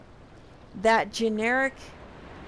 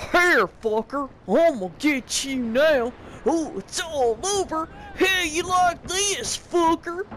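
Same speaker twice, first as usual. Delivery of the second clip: louder, higher mock-yelled hillbilly villain taunts; shouted bad guy taunts; 5 semitones higher, 4 dB louder than usual. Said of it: "shout out generic bad guy voices".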